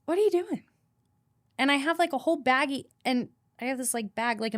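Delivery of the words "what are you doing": alarmed question. Retold or imitated. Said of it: "she's like".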